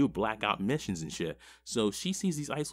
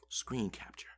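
whispered, softer repeat; 7 dB quieter than usual. Real, not acted. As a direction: breathe the words out quietly. Repeating the instruction for emphasis.

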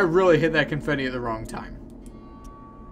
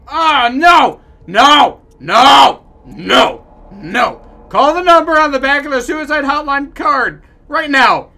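high, much louder alarmed no; panicked shouting; 9 semitones higher, 13 dB louder, slower than usual. Pretend. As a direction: stop them in horror. Panicked shouting at suicide hint.

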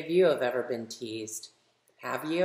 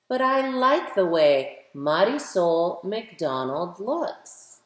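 put-on lively, louder storybook reading for kids; reading to children; 4 semitones higher, 7 dB louder than usual. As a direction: read it like a picture book for a class, brightly. Reads picture book aloud to children.

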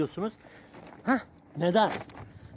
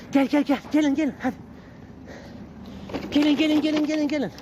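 calling the puppies; 9 semitones higher than usual. Real, not acted.

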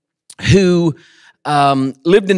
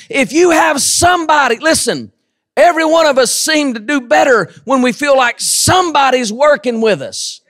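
preaching; 9 semitones higher than usual. Acted. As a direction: build the sermon to a shout. Preaching at full force.

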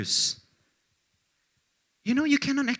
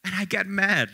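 ordinary talk

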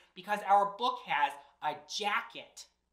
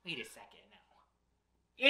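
plain reading voice

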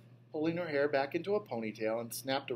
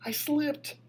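put-on a scared kid hollering high; hollered; 11 semitones higher, slower than usual. A narrator's reading. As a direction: yell it out to stop her coming. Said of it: "I hollered".

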